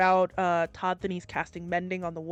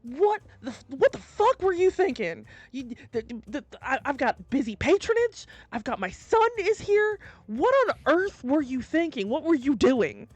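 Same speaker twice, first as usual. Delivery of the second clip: higher, louder furious scolding; outraged rant; angry brother; 6 semitones higher, 4 dB louder than usual. Acted. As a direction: tear into the careless brother. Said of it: "just goes".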